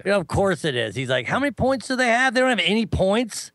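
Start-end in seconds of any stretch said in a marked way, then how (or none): 1.3-3.5 high-pitched mock of a clueless friend; put-on high, puzzled questioning; clueless baseball novice. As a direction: imitate a naive fan, pitched way up. Voices clueless friend asking about baseball.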